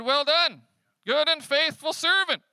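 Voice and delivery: high, loud joyful shout; master yelling praise. Acted. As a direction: shout the praise with an exclamation point. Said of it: "He's yelling it".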